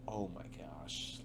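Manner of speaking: slow, hushed aside, as if to oneself; quiet dismay. Retold or imitated. Driver reacting to a smelly passenger in the truck, hushed dismay.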